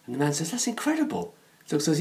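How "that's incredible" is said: high, soft astonished reaction; amazed. Acted. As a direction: voice leaps up in hushed disbelief. Amazed man reacting to talking dog.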